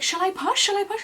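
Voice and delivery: high, urgent questioning; frantic asking. Retold or imitated. Labouring woman asking midwives about pushing, frantic high question. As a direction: ask it twice, pitch shooting up.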